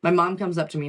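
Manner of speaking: ordinary talk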